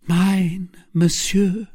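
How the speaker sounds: high, faint woman's answer; high-pitched, failing voice; dying voice. A narrator's reading. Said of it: "in a dying voice".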